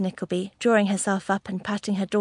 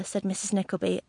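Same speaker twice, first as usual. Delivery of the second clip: usual speaking voice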